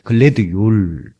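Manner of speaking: 글래드 율 slow and gentle; 글래드 율을 부드럽고 천천히; soft Swedish. Shown as a demonstration. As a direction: say 글래드 율 softly, drawn out. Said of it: "스웨덴은 좀 부드러워요".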